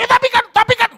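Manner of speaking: high protesting excuse; whiny protest. Retold or imitated. Spouse protesting an apology, high and whiny. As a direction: whine the excuse.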